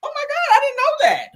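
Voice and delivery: imitating Phaedra playing innocent; fake surprise. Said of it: "making all them faces like".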